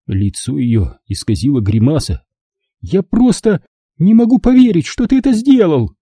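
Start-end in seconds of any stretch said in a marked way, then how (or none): 2.8-5.9 high, indignant mother; can't believe he did it; upset disbelief. Acted. (мать в ярости и неверии)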